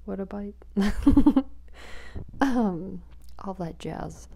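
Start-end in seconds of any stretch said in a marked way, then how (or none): 0.6-2.4 giggle. Real, not acted. Laughs at own confusion.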